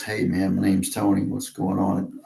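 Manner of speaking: showing off his natural Texas accent; his own Texas accent, casual; real Texas voice. Shown as a demonstration. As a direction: let the Texas accent come through relaxed. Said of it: "that's what I actually sound like".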